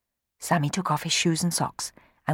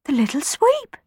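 amazed; 11 semitones higher than usual. Acted. Rowan astonished at Sammy.

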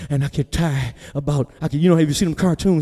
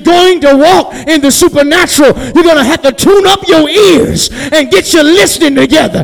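high, loud, driving preacher shout; full-force preaching; 12 semitones higher, 11 dB louder than usual. Real, not acted. Preacher urging the congregation to hear, full shouted cry. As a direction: build to a sustained, high, shouted climax.

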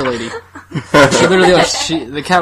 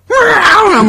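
high loud squawk; mock squeal; 7 dB louder than usual. Voicing the cat's reply, high loud squeal.

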